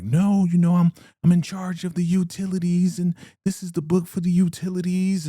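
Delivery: high, loud, defensive protest; indignant woman. Retold or imitated. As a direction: pitch it way up, insisting she's in charge. Joan defending her book.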